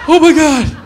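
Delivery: high, loud horrified gasp of a line; mock horror. A comic sees whiskey poured for him, loud mock horror. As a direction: act out the dread of the full glass. Panicked reaction, far voice.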